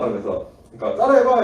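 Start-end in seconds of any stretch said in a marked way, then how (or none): 1.1-1.4 1 daring challenge. Retold or imitated. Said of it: "해서".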